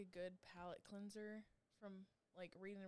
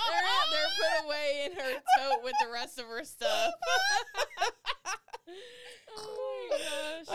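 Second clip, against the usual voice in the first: laughing; 10 semitones higher, 20 dB louder than usual. Real, not acted. Joke amid laughter.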